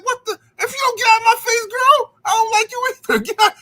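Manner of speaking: put-on high, loud, fast kid yelling back; mock angry comeback. Retelling his lunchroom comeback to the girl, high mock yell. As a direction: yell it back, half laughing, like a stung kid. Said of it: "I'm like".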